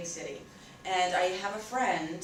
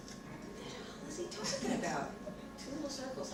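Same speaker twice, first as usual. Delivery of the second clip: high, hushed bewilderment; baffled; 6 semitones higher, 8 dB quieter than usual. Retold or imitated. Thinking over friend's odd tuna remark, baffled. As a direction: puzzle it out softly, pitched up. Retold baffled inner thought.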